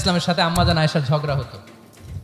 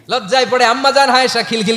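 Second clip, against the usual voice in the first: ordinary delivery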